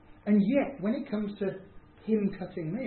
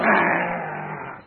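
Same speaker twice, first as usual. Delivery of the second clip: mock angry driver; 7 dB louder than usual. Angry driver through window.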